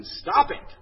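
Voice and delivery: high, loud retold shout. Psychiatrist to patient with bad relationships, comic shout. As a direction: shout the order as a comic therapist. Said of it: "he shouts again".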